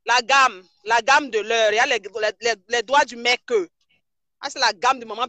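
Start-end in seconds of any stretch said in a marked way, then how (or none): 0.0-3.4 louder, eager and insistent; excited loud showing off. Real, not acted. Blogger showing fans the guy's fingers, excitedly louder. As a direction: call out louder, thrilled to show it off.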